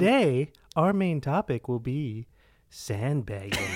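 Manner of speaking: slow filtered robot voice. Acted. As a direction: announce it like a machine. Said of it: "That sounds so bad".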